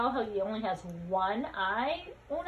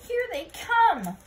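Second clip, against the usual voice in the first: much higher, loud, slowed alarm; holler; 9 semitones higher, 7 dB louder, slower than usual. Acted. Scapito hollering as the Martians arrive. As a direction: yell a warning. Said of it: "hollered Scapito".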